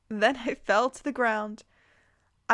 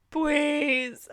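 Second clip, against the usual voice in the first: higher, pleading mock yell; yelled back; 5 semitones higher than usual. A narrator's reading. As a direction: call it back as a whiny plea. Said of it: "I yell back".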